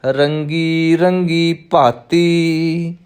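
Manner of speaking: measured chant-like recital with drawn-out line endings; scripture recitation. Shown as a demonstration. Teacher reciting Gurbani for new students, measured chanted recitation. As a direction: recite slowly on a level, sustained tone.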